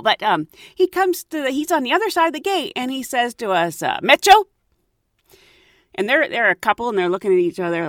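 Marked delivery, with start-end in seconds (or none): 4.0-4.4 high, loud quick question; guard calling out. Retold or imitated. Gate guard calling the couple's name across the gate, loud clipped question. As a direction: fire the word across the gate.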